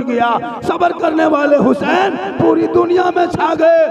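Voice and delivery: rally the believers with building fervour; fiery preaching. Acted. Rousing sermon climax. (जोशीली तक़रीर, ऊँची आवाज़ में ललकारते हुए)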